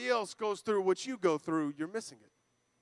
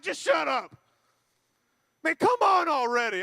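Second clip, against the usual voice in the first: fed-up outburst; 4 semitones higher, 4 dB louder than usual. Acted. Acts listener fed up with friend.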